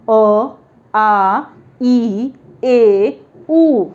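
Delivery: "अ, आ, ई, ए, उ" one by one with gaps; vowel sounds demonstrated. Shown as a demonstration. Teacher repeating the five vowels for students, demonstrating each of "अ, आ, ई, ए, उ". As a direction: sound out "अ, आ, ई, ए, उ" separately and firmly. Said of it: "ये पांच vowel उच्चारण generally आते हैं".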